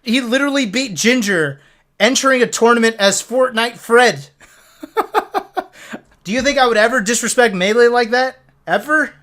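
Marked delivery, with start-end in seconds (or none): 4.8-5.6 high giggle. Real, not acted. Laughing at Fortnite Fred.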